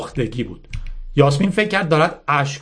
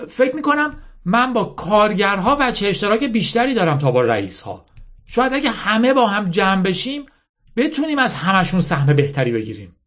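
higher-pitched, lightly acted earnest girl; earnest young Yasmin; 7 semitones higher than usual. Yasmin reasoning with her army's general, lightly voiced earnest girl. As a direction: make a heartfelt case in character. Yasmin argues after deep breath.

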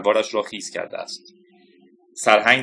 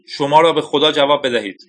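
urgent plea; 4 semitones higher, 4 dB louder than usual. Acted. Colonel begging radio for reply.

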